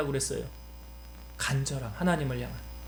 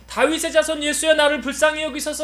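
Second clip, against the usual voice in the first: raised, higher pleading cry; blind man crying out; 7 semitones higher, 5 dB louder than usual. Retold or imitated. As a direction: call out to Jesus for mercy. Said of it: "더 크게 소리쳤잖아요".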